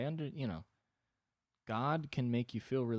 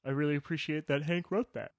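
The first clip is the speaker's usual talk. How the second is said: high, chill agreeable voice; God as an easygoing buddy; laid-back God voice; 7 semitones higher, 5 dB louder than usual. Retold or imitated. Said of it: "He's like".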